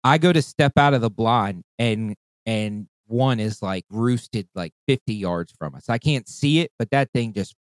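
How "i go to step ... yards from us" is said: ordinary talk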